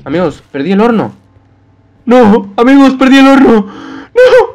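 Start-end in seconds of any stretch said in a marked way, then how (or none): 2.0-4.5 high, loud cry of dismay; dismayed wail. Real, not acted. Player tells friends the furnace is lost, high dismayed wail. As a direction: wail over the loss.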